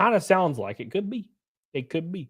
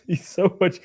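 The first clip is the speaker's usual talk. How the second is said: ordinary delivery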